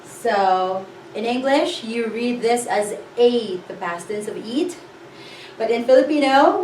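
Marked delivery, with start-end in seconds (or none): none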